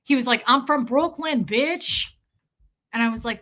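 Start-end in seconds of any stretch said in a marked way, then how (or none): 0.5-2.1 mock Brooklyn tough guy boast; swaggering New Yorker. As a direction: imitate a cocky dude staking his turf. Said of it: "another New Yorker, a dick swinger".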